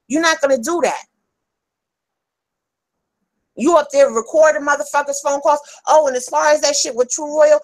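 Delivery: real heated tirade, pitched high; high-pitched, fed-up confrontation; angry rant. Real, not acted. Angry tirade at absent rival.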